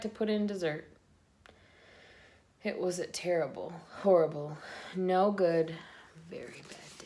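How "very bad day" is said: unvoiced whisper trailing off; whispered refrain. A narrator's reading. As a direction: breathe the last words out.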